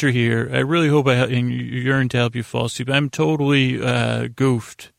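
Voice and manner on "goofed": usual speaking voice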